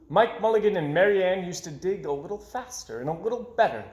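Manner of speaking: plain reading voice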